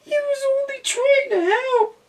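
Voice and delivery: very high tearful cat voice; crying cat. A narrator's reading. Cat crying over missing duck, tearful. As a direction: sob it out as the cat. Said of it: "whooped the cat".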